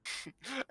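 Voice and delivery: high, soft short cackle; old lady's cackle. Acted. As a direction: let out a little crone's cackle. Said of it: "She, she cackles".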